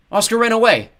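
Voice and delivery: very high, loud yell. A narrator's reading. Mob announcing the escape to the crowd, yelling. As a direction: shout the alarm to the whole crowd. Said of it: "the men were yelling".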